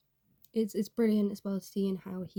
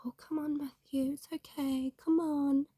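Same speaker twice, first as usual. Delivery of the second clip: gentle coaxing; 6 semitones higher than usual. Retold or imitated. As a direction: coax him gently up the hill. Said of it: "They're being like".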